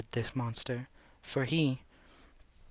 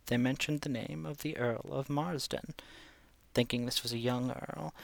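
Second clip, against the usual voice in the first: usual speaking voice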